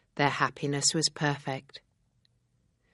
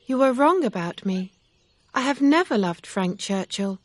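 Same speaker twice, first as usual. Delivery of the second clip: higher, louder storybook heroine; Emma, earnest; 6 semitones higher, 5 dB louder than usual. Acted. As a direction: set him straight in a raised, high-pitched character voice.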